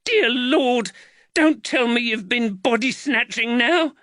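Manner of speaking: high, loud, appalled outburst; scandalised woman, voice way up; shrieking mother. A narrator's reading. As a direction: scold your son at a shriek. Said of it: "Jaquetta Cagowan shrieked".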